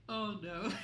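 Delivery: laughing through near death; giggling in disbelief at zero health. Real, not acted.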